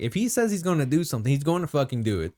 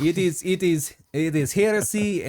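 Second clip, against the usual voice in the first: higher-pitched mock Russian accent; 5 semitones higher than usual. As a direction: insist in a put-on Russian accent. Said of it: "tried to do the Russian accent".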